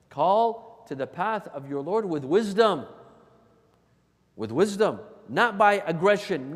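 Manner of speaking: loud, insistent sermon; emphatic admonition from the pulpit; forceful preaching. Acted.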